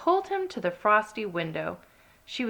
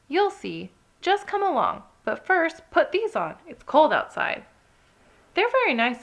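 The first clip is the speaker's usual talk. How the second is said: high, lively little rabbit; high eager rabbit voice; 8 semitones higher, 5 dB louder than usual. Acted. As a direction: coax him along cheerfully. Rachel and Ted dialogue voiced high.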